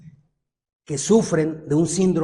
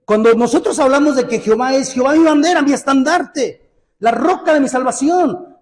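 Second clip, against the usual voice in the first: louder and higher, stretching out each title; rising preaching; 5 semitones higher, 4 dB louder than usual. Acted. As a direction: proclaim the names of God with fervour. Preaching builds on God's names.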